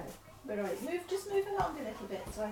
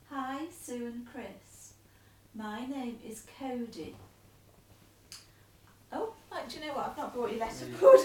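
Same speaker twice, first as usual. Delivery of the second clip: letter read aloud; 7 dB louder than usual. Acted. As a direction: read the letter out steadily to him.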